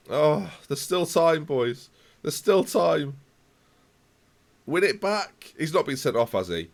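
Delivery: ordinary talk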